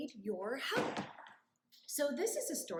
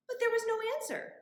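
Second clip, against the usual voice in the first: surprised; 11 semitones higher, 6 dB louder, much faster than usual. Acted. Story surprise beat.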